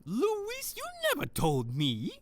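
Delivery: high, wounded reproach; hurt Hans. Acted. Hans learning his ex kept a secret, high and hurt. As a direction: let the pitch climb with the hurt.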